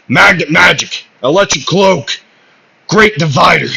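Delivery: loud, high battle cry; spell-casting shout. Acted. Asta unleashing his magnet magic, shouted battle cry. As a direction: call out each attack with force.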